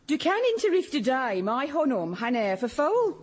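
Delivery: Swedish line with Birmingham vowels, a mock Brummie mother; Swedish with a Birmingham accent. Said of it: "she grew up in Birmingham and never quite lost the accent".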